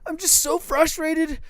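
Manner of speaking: high, slow whiny wife voice; mimicking frustrated wife. Husband imitates wife explaining the ripped drawer, high and whiny. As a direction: whine it like the upset wife. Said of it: "She's like".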